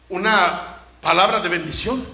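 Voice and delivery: usual speaking voice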